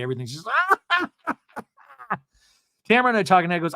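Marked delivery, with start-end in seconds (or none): 0.5-1.3 high mock cackle; hysterical laugh, imitated. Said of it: "she laughs hysterically".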